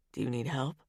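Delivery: male character voice. A narrator's reading. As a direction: ask as the wary hero. Roman in Elena's chapter.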